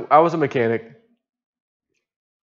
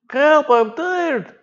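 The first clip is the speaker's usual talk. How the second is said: high, slow, drawn-out whimper; whiny tired plea; 14 semitones higher, much slower than usual. Retold or imitated. A tired diver whining to the boat crew, high and slow. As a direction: stretch it out like an exhausted man pleading. Imitating Darius again.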